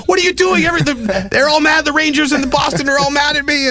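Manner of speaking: very high, frantic mock voice; Aaron Ward. Traded player complaining to the reporter, high panicked imitation. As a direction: imitate an upset player wailing down the phone. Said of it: "Ward was on the phone five minutes later going".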